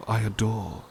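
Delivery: ordinary talk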